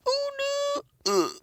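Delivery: very high startled oh no; shrill surprised cry; squeaky dismay. Real, not acted. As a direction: yelp it in surprise. Sudden dismay.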